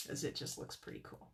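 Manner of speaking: soft whispered aside; whispering. Real, not acted. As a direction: breathe it out in a whisper. Unvoiced aside to viewers.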